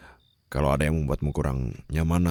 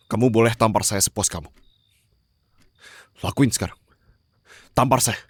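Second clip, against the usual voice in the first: urging to slap; 6 semitones higher, 5 dB louder than usual. Acted. Offers to be slapped.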